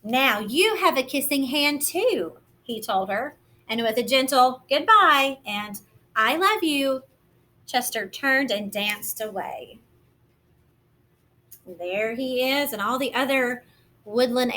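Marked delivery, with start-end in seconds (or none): 0.0-9.8 gentle, lively picture-book narration; reading to children. A narrator's reading. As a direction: read it like storytime for little ones.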